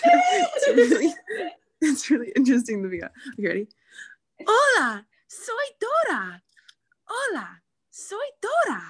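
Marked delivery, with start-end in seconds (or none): 4.5-8.8 high cartoon girl voice; Dora impression. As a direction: chirp it like the little explorer. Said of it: "Dora the Explorer".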